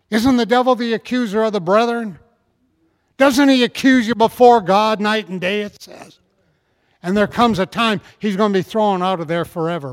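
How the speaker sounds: loud, high preacher's cry; fiery pulpit shout; preaching at full force. Acted. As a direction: rouse the congregation, full voice.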